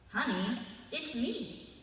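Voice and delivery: slow, fond, with a little laugh; calm her down gently, chuckling; reassuring husband. Acted. Husband reassures his wife.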